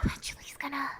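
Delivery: soft mock whisper; whispered rumor. Streamer imitating rumors about the game's end, whispered. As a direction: imitate people whispering gossip. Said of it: "like, whispers, like, hushing".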